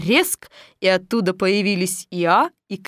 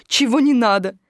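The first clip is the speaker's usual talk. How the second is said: ordinary delivery, nothing marked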